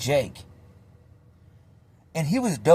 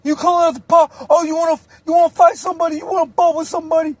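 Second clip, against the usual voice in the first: high, loud taunting questions; mock the challenger, pitch way up; high squawking tough-guy voice; high mock challenge; 13 semitones higher, 4 dB louder, faster than usual. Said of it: "He's like".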